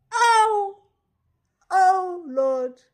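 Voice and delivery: shocked cry. Real, not acted. Reacting to the cancer prayer request, shocked cry. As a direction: blurt it out as the shock lands.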